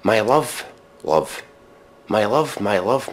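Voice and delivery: ordinary talk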